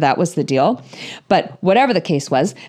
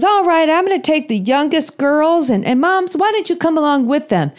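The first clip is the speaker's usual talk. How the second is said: higher folksy wrangler voice; cowboy wrangler; 7 semitones higher than usual. Retold or imitated. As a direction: round up the little girls like a trail boss.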